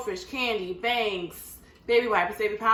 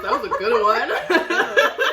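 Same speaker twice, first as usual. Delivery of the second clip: laughing; 3 semitones higher, 11 dB louder, much slower than usual. Real, not acted. Laughing at a burp.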